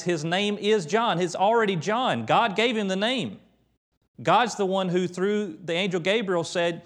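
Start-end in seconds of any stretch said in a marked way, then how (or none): 0.0-3.4 higher, firm emphatic declaration; emphatic, not up for debate. Retold or imitated. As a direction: insist it is already settled. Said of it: "He's even more emphatic".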